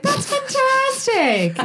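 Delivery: high, slow and gushing; overly delighted reply; mock enthusiasm.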